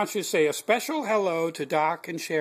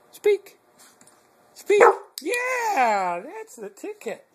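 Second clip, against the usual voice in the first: excited coaxing; 11 semitones higher, 6 dB louder, slower than usual. Acted. Urging dog to bark.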